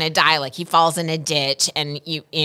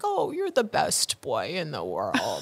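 slow and softer, cooing; tender to her pets; 3 semitones lower, 7 dB quieter, slower than usual. Real, not acted. Says it to her own boys.

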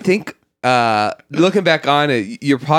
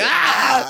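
mock scream; 13 semitones higher than usual. Tree being cut, acted.

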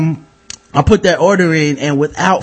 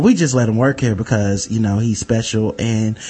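low smug manager; 8 semitones lower, 4 dB quieter than usual. Retold or imitated. Manager excusing the new cook, low and hushed. Manager voiced in retold story.

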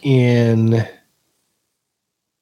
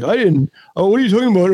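flustered troll; 7 semitones higher, faster than usual. Retold or imitated. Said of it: "they're always like".

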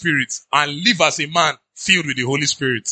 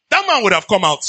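ordinary delivery

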